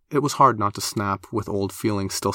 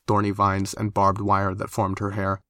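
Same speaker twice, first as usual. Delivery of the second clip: plain reading voice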